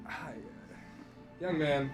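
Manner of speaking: ordinary delivery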